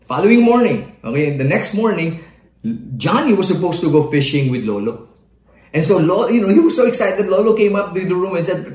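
usual speaking voice

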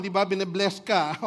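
ordinary talk

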